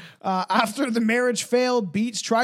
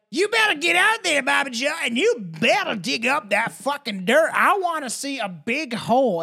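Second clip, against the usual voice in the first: put-on high, loud Texas mom barking orders at her kids; mock Texas mom; 6 semitones higher, 4 dB louder than usual. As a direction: boss the kids around in a Texas twang. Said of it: "There's like".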